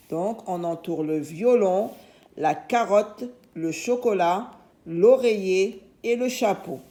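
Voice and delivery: usual speaking voice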